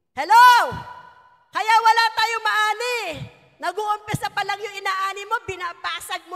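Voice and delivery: preacher bellowing at the hall; calling out. Real, not acted. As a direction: wake the congregation up. Preacher calls out to congregation.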